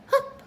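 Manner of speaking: sudden squeaky hiccup; high hiccup. A narrator's reading. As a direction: let a tiny hiccup pop out high. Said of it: "said Roo again".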